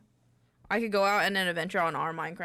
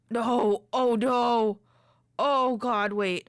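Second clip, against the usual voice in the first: loud alarmed cry; panicked; 3 semitones higher, 7 dB louder, much slower than usual. Real, not acted. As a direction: yelp in sudden panic. Creeper danger in game.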